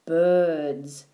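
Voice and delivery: mysterious. A narrator's reading. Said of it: "said the frog mysteriously".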